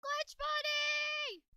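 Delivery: very high, loud, drawn-out shout; long high-pitched yell across a distance; calling out. Pretend. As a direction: holler the name and hold it. Calling out for Glitch Buddy.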